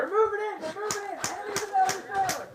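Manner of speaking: urgent callout. Real, not acted. Warning of enemies mid-firefight.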